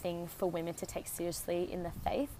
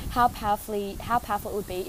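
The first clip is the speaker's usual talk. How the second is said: raised voice against the wind; shouting over wind; 2 semitones higher, 7 dB louder than usual. Real, not acted. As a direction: project loudly over gusts. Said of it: "hopefully you can hear me over this wind".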